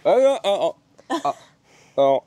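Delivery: gagging and retching; dry heaving. Real, not acted. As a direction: heave between short cries. Gagging on the sausage. (haut-le-cœur)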